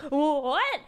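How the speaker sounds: loud, rising yelp of surprise; high, loud disbelief; startled. Retold or imitated. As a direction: balk at what you've been handed. Startled at being handed iguana.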